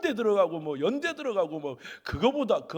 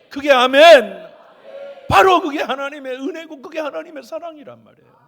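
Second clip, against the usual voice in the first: loud, high, rising preaching; preaching at full force; 7 semitones higher, 13 dB louder than usual. Acted. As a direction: cry it out to the congregation. (목청껏 외치는 설교)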